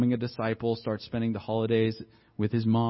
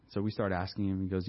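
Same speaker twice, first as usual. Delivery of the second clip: normal talking voice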